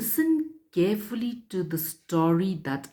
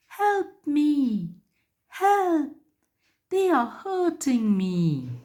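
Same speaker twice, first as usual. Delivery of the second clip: much higher pleading cry of a hurt tree; tree crying for help; 10 semitones higher, slower than usual. Acted. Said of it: "The tree cried out in pain".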